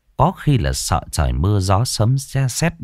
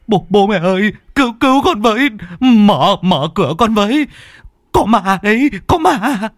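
very high, louder pleading screams; desperate cry for help; 13 semitones higher, 4 dB louder than usual. A narrator's reading. Son pounding on his parents' door, screaming high in panic. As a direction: beg them to open the door, panicking.

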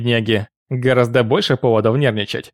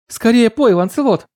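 excited call; 11 semitones higher than usual. Acted. Child shouting at Lancelot.

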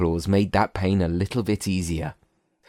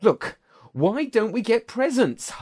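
high voice; 13 semitones higher than usual. Acted. James voice, high.

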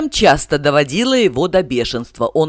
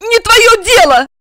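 high, loud angry shout; 7 dB louder than usual. A narrator's reading. Regina shouts at Igor as she runs away, loud and high. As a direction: snap it back while running off.

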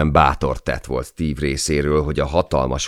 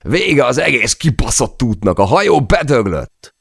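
high, loud cursing shout; raging that the boat is dead; furious yell; 10 semitones higher, 5 dB louder than usual. Acted. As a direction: spit the curse out in a rage. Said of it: "ordította vissza".

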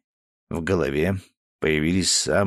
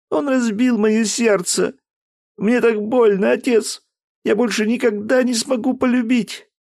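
heartbroken daughter; 5 dB louder than usual. Acted. Daughter telling her father of her pain. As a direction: sound broken-hearted.

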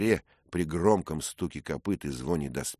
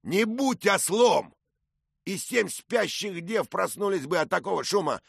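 angry knight; 4 dB louder than usual. Acted. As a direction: berate him through the door. Knight shouting at door.

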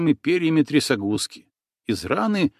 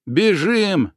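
urgent call; 7 semitones higher than usual. Acted. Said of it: "живо сообразил татарин".

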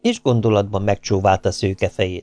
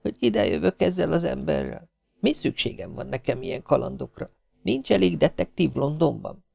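soft, raised-pitch private grumble; inner monologue; 6 semitones higher, 8 dB quieter than usual. A narrator's reading. Maisie questioning her adventure with this man, in hushed self-talk. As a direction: fret to yourself under the narration, softly.

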